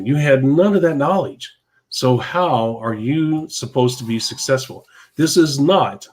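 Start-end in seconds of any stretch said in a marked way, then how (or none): 5.2-6.1 not hit high and hard; stress on not. Real, not acted. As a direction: lean on the word not, make it final. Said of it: "I emphasize the word not".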